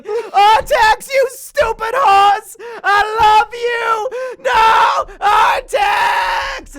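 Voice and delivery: high, loud, slow hammed-up wail; mock anguished yell. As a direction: cry out for the drowning horse, over the top. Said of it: "Atreyu's yelling".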